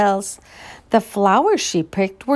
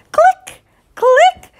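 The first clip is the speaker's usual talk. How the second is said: high bright camera click; 7 dB louder than usual. Acted. Reader makes the camera's click sound. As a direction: chirp the click like a tiny shutter.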